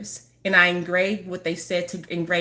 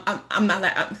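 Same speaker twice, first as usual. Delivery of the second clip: usual speaking voice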